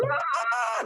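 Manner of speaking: high, drawn-out "aah"; retold manic yell. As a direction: act out a wild goal celebration scream. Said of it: "there going".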